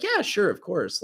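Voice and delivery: high, casual mock agreement; put-on nonchalance; playing it off. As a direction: act like it is no big deal. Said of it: "playing it off".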